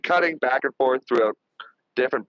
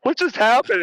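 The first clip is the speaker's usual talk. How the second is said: higher-pitched mock bewilderment; stunned reaction; 7 semitones higher than usual. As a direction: imitate a group caught off guard. Acting everybody's stunned reaction.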